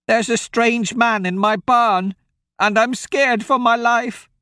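put-on high, pleading old woman's voice; a scared old farm wife begging for help; frightened old woman. Old woman begs two strangers to help.

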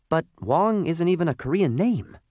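high-pitched little coal voice, objecting; small high voice of a talking object; talking lump of coal. Acted. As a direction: object politely, pitched well up.

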